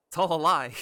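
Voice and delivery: loud, hammed-up cry of betrayal; mock dramatic shout. Real, not acted. Dramatic outburst at game twist.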